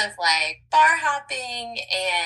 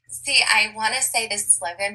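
ordinary talk

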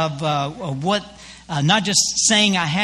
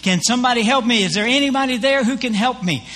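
higher-pitched mock cry for help; 5 semitones higher than usual. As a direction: call up for help, moderately raised voice. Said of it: "he cries out with a loud voice".

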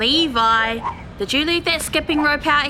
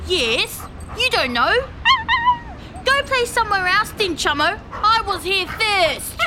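higher, bossy sibling retort; annoyed; 7 semitones higher than usual. Real, not acted. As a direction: tell your brother off. Bickering with sibling Chummo.

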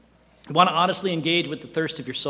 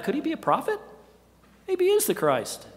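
muttering curious crowd; 12 semitones higher than usual. Retold or imitated. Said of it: "muttering under the breath".

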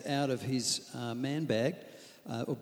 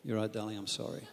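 much softer, lower, with a laugh; soft apology; 4 semitones lower, 6 dB quieter than usual. Acted. Husband making peace with his wife, soft and lower. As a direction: give in gently, lowering the temperature. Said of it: "drops it down to peace area".

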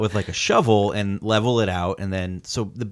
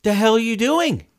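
much higher, louder disbelieving cry; stunned yell; 13 semitones higher, 5 dB louder than usual. Retold or imitated. Husband confronting the driver at her car, stunned yell. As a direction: let the shock burst out.

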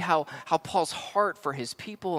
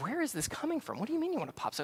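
baffled parent; 9 semitones higher, 7 dB quieter, much faster than usual. Retold or imitated. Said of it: "I'm like".

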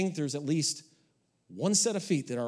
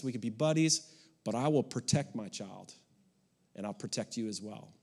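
put-on low, softer, steady talk to the boyfriend; calm, direct father; 6 semitones lower, 4 dB quieter than usual. Father to daughter's boyfriend, quiet and direct. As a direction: lay it out plainly, man to man. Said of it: "being very direct".

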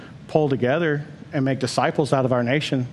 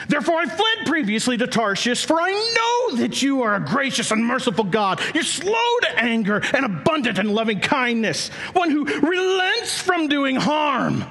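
Jonah's bitter prayer; 8 semitones higher than usual. Retold or imitated. Pastor reading Jonah's prayer as an accusation, raised bitter cry. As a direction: hurl the complaint at the Lord. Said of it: "That's how this verse is read".

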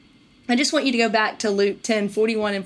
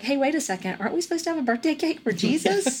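put-on higher, faster, eager little-kid whine; mock kid voice; 6 semitones higher, faster than usual. Kids reminding mom about the Jesus cake, mock eager child. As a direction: play a kid tugging at mom's sleeve.

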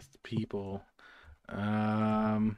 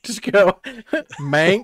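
laughing; 9 semitones higher, 9 dB louder, slower than usual. Real, not acted. Laughing through the title.

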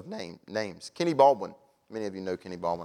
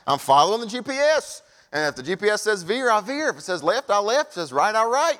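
higher and louder, punching each step; emphatic preaching; 8 semitones higher, 5 dB louder than usual. Real, not acted. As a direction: drive it home with rising fervour. Emphatic about obeying the GPS.

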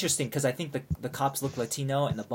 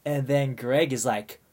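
plain reading voice

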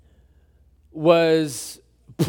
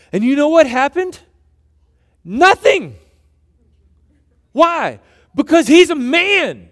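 louder, higher, forceful sermon delivery; preaching; 10 semitones higher, 8 dB louder than usual. Acted. Pastor driving home his point to the congregation, preaching loud and high. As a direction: preach it with conviction.

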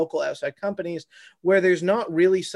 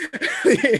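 laughing; 13 semitones higher, 4 dB louder than usual. Real, not acted. Laughing at own joke.

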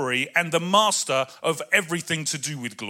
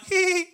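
mock giggle; 13 semitones higher, 4 dB louder than usual. Imitating Nikki's giggle.

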